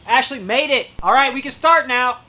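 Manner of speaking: loud, high-pitched welcome; excited greeting. Real, not acted. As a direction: call out happily to a late arrival. Calling out to arriving viewer.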